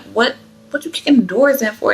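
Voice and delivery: startled what-for; alarmed. Retold or imitated. Alarmed question.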